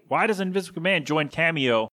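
usual speaking voice

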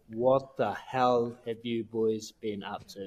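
slow, stern manager voice; media manager. Retold or imitated. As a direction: drag out the scolding. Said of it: "he's like".

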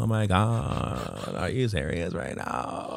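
put-on slow, drawn-out valley girl with vocal fry; mock Californian valley girl. Shown as a demonstration. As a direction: do a creaky valley girl, dragging the vowels. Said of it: "You can be like a valley girl".

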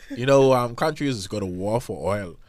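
ordinary delivery

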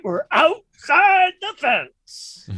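high, slow drawn-out announcement; megaphone announcement. Acted. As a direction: announce it slowly through the megaphone.

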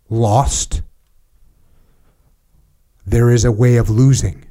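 slow, weighty oratory with long pauses; solemn dramatic reading. Acted. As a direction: read it like a rousing battlefield address that settles into grave reflection. Reads the book aloud.

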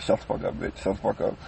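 a secret whispered curse while the trouble starts. Retold or imitated. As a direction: mutter it so nobody hears. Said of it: "man, I was like".

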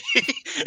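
high, loud real burst of laughter. Real, not acted. Host cracks up retelling the wrestlers' post-match exchange, loud laugh. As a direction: crack up at the punchline. Laughing at her own story.

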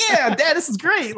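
high, drawn-out mock-delighted kid; imitated enthusiastic kid. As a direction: play a son cheering his dad on. Plays the kid cheering Dad on.

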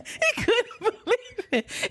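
high, slow words through laughter; can barely tell it for laughing; helpless amusement in the voice; laughing while talking. Real, not acted. Teller laughing at the retold scene.

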